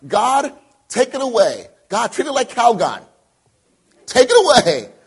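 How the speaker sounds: loud, high mock plea to God; pleading prayer. Acting out a desperate prayer.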